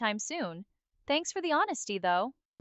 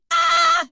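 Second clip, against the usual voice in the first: very high loud wookiee shriek; screaming Chewbacca cry; 10 dB louder than usual. Pretend. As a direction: let out a piercing creature scream. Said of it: "a Chewbacca screaming".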